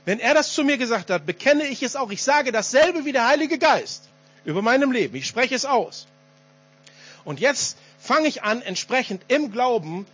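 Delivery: höher, mit Nachdruck verkündet; kraftvolles Predigen. Real, not acted. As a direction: predige es mit voller Überzeugung, Schlüsselwörter herausgehoben. Preaches at full force. (leidenschaftliche Glaubensproklamation)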